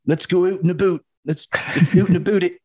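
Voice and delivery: high mock Canadian accent; hammy Canadian voice actor. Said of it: "voice actors going".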